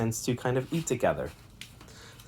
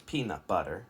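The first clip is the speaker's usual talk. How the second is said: PEANUT BUTTER as four even beats, softer; four syllables; 4 semitones lower, 8 dB quieter than usual. Shown as a demonstration. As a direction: tap out pea-nut but-ter evenly. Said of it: "peanut butter has four".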